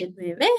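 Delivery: "nueve" swept upward; rising question ending. Shown as a demonstration. Teacher pointing out the end of the question, rising tune. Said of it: "at the end".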